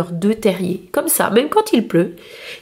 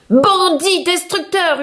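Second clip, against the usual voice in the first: much higher, slow yelled insult; shouted insult; 8 semitones higher, slower than usual. A narrator's reading. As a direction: hurl it at the wall-breaker. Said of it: "hurlait Monsieur Brun". (insulte hurlée par Monsieur Brun)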